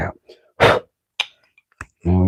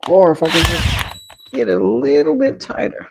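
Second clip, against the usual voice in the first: mock high voice; 11 semitones higher than usual. Mimicking a perfectionist's inner voice.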